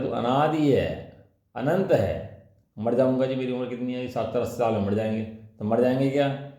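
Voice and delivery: ordinary talk, nothing marked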